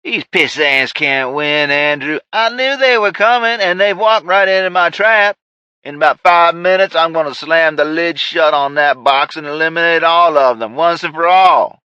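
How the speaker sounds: high, loud gloating threat; manic villain rant. Acted. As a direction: crow about the trap like a madman. Ray ranting at Andrew.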